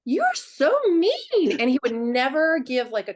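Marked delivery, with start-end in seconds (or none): 0.0-1.5 whiny complaint. Retold or imitated. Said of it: "And I'm like".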